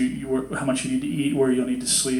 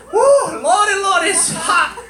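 very high, hammed-up falsetto whoop; drawn-out, overcome-by-heat exclamation in a put-on voice; old-time fanning churchgoer; 4 dB louder than usual. Congregant with a fan in the forties, hammed-up swooning.

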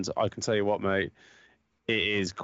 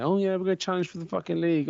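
higher, fast mock-cheery boast; mocking optimism; 8 semitones higher, faster than usual. Mocking United fans' title hopes, fast high boast.

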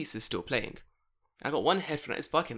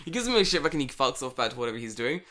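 ordinary talk, nothing marked

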